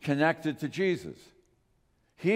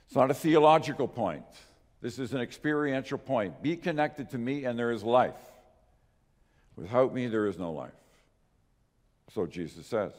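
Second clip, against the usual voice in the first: ordinary delivery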